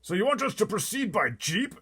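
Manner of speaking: high, loud frightened cry; a blustering general in a panic; terrified army chief. Acted. Army head crying out from the back seat, terrified.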